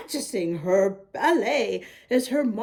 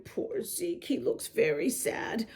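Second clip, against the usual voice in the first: soft, much lower pitying whisper; whispered aside; 4 semitones lower, 10 dB quieter than usual. A narrator's reading. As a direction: lean in and whisper with pity. Reader's pitying aside to listener.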